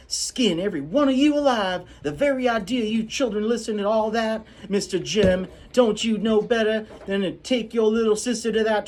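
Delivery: high, loud scolding housekeeper; scolding Calpurnia. Acted. Calpurnia scolding the children on the way home. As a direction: tear into the children. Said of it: "you do different voices for every character".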